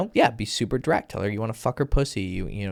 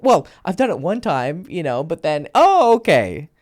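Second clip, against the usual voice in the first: put-on girl's voice; 6 semitones higher, 7 dB louder than usual. Acted woman's reply, girly voice.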